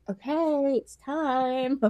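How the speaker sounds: soft, coy invitation; trying to be sexy. Acted. Bride coming to her husband on the wedding night, mock sexy. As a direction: put on a mock-seductive softness. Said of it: "trying to be, like, sexy".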